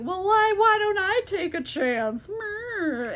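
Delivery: put-on high, creaky old-man caricature; hammed-up feeble codger piping up; crusty old guy. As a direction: imitate a doddering old fellow. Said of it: "crusty old guy".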